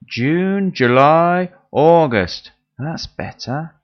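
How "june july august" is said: loud unison chant of the months; group repeating after leader. Pretend. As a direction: class chanting in chorus. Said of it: "Together, please".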